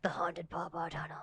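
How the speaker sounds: whispered spooky ghost-story voice; breathy eerie whisper for a haunting; haunted voice. Pretend. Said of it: "That's my haunted voice if you hear it".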